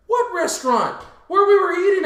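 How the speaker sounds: high, loud back-and-forth; hammed-up bewildered shouting; retold exasperated exchange. Friends arguing over a forgotten restaurant, loudly baffled.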